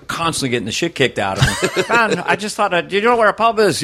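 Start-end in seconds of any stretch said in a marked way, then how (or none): 1.9-3.8 high, lilting mock Irish; playful Irish accent. Irish immigrant asking for a pub, playful Irish accent. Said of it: "when the Irish came".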